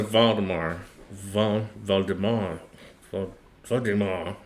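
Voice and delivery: Valdemar with a German V, in a Tennessee German accent. Shown as a demonstration. Trying out the name with a German V, in a Tennessee German accent. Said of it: "My Tennessee German accent".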